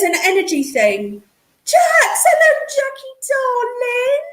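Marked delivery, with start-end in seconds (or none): 1.6-4.2 excited greeting. Real, not acted. Greeting a friend joining stream.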